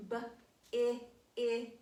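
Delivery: "B-I-I-G" with the short i drawn out; teacher modelling the short i; sounding out. Shown as a demonstration. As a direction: stretch the short vowel in "B-I-I-G".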